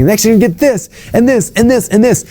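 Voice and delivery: mock salesman piling items on, high; high parody, rising on each and this; hyped pitchman building. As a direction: rattle off bonuses like a cheesy pitchman. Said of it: "they're like".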